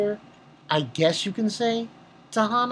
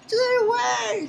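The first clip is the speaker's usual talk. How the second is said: high, slow, small voice; mock high plea; slower than usual. Hana pushing Daichi away, high slow mock plea. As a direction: squeak it out like a scared girl. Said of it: "she's like".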